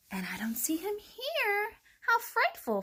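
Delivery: high, lightly voiced fox dismay; muttered. A narrator's reading. Fox pretending to search, high muttering. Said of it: "Foxy Loxy muttered".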